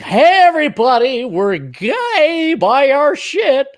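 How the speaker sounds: mock corporate cheer. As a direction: announce it like an overeager corporation pandering to customers. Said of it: "which corporation was it that had the rainbows and everything like".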